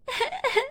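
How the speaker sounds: a short high cackle at her; mocking laugh. Bully cackling at the crying girl, mocking laugh.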